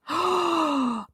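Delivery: long dramatic gasp; mock gasp. Streamer imagines being stuck there forever, mock horrified gasp. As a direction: suck in air in pretend horror. Pretend horror at being trapped.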